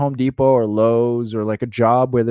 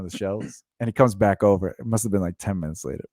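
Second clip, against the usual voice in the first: usual speaking voice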